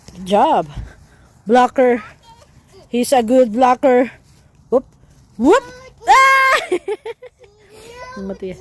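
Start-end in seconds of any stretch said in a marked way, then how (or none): 6.0-6.6 high, short squeal; sudden high cry; playful shriek. Real, not acted. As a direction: yelp as the ball flies. Shriek during kick game.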